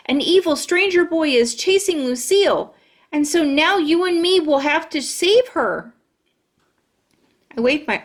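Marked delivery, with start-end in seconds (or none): 0.1-5.9 pitched up with urgency; rally her friend to the rescue; urgent excitement. Acted.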